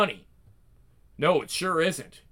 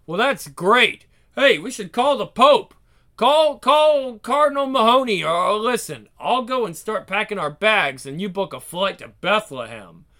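sarcastic; 5 semitones higher, 6 dB louder than usual. Acted. Wife mocks husband's suspicion.

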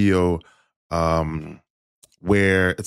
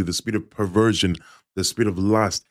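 ordinary delivery

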